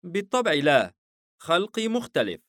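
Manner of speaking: puzzled, mildly indignant surprise; taken aback. A narrator's reading. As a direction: answer in astonishment, pitch lifted. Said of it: "ليفياثان مناظرًا إيزيس باستغراب".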